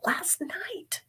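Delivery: a breathy secret correction of "Last night"; hushed whispered repeat of the words last night; whispered aside. Real, not acted. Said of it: "whispered repeat".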